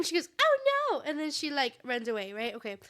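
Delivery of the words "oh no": high-pitched mock dismay; hammed-up high "oh no"; alarmed princess. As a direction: play a flustered fairytale princess. Acts out startled princess fleeing.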